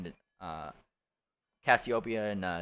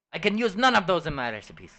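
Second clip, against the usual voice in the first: dismissive chef, higher and louder, sending them off; a snooty griffin chef shooing away a customer; haughty French chef griffin; 9 semitones higher, 8 dB louder, faster than usual. Acted.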